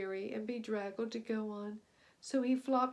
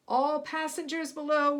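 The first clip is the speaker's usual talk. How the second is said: higher, louder captain's command; loud order; 5 semitones higher, 8 dB louder than usual. A narrator's reading. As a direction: order everyone below. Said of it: "ordered the captain".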